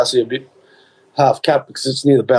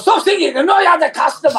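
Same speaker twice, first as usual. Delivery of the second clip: high, exasperated mock Chinese accent; 12 semitones higher than usual. Shopkeeper fed up with a singing customer, high Chinese accent. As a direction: imitate a pleading, annoyed Chinese-accented shopkeeper. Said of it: "doing my Chinese accent last week".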